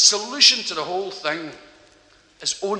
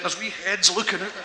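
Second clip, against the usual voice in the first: ordinary talk, nothing marked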